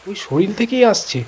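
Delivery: plain reading voice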